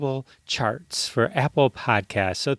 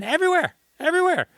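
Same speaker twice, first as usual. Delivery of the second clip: excited; 13 semitones higher, much faster than usual. Real, not acted. Hyping guest's credits.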